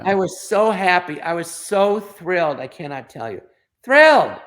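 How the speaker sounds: overjoyed, emphatic recollection; thrilled. Real, not acted. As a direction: stress each "so", burst out on the last word. Recalls his joy working with idol.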